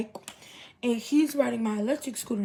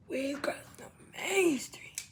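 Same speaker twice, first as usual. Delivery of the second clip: "We crossing the main street" whispered, softer and slow; tense whisper; 3 semitones higher, 7 dB quieter, slower than usual. Retold or imitated. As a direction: hush "We crossing the main street" like sneaking across.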